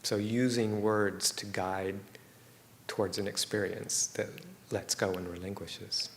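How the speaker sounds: unhurried meditation guidance with long silences; guided meditation. Real, not acted. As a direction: guide them gently into stillness, leaving space between phrases.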